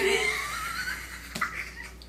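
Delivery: very high laughing shriek; real delighted laugh. Real, not acted. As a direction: squeal with laughter. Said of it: "why that is so funny to me".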